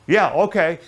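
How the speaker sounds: retold shouted yes; casual loud agreement. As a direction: driver agreeing to tow them. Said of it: "And he goes".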